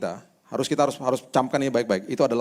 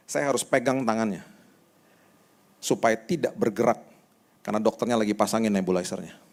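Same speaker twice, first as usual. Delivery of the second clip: ordinary talk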